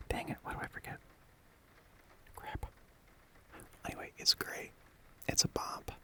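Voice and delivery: gentle close whisper throughout; cozy ASMR whisper. Real, not acted. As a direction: whisper close to the mic like comforting a friend. Whispers to comfort listener.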